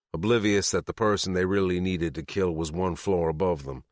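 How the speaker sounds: usual speaking voice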